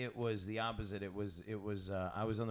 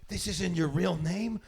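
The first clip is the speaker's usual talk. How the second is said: louder, higher, drawn-out disbelief; mock incredulity; 7 semitones higher, 7 dB louder, slower than usual. Host playing someone learning of the stage name, mock incredulous. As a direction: exaggerate the shocked question, slowly.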